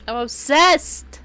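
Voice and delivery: loud, high, drawn-out declaration; unrestrained gushing. Real, not acted. Player declares her obsession with the couple, loud gushing. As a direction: burst out with it, stretching the word.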